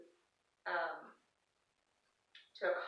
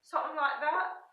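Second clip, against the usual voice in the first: higher, louder mock British accent; 7 semitones higher, 4 dB louder than usual. Shown as a demonstration. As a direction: try on a British accent for fun. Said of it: "they talk with a British accent".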